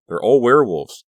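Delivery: loud high cry; panicked; horrified. A narrator's reading. Realizing horror.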